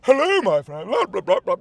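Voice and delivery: lightly hammed-up stage enunciation; a hint of a pompous stage voice; mock theater actor. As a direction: parody a classically trained actor, a touch grand. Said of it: "people who made it in theater".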